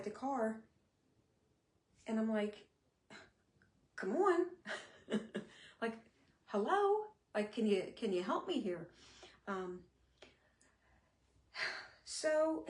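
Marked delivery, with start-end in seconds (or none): 3.9-4.5 louder, higher exasperated "come on" at own leg; a fed-up nudge at a leg that won't move; exasperated plea. Retold or imitated. Urging stuck leg, exasperated. 6.5-8.9 calling out to a leg that won't listen; mock calling out.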